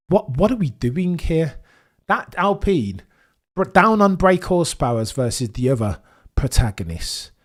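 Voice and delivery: disbelieving live excitement; excited commentary. Real, not acted. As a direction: call it like a thrilled commentator. Live commentary on Gasly's pace.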